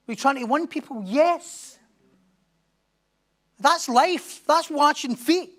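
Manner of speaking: loud, high, driving preacher's climax; preaching at full force. Real, not acted. Pastor urging the church on, preaching at full force. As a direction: drive it home to the congregation.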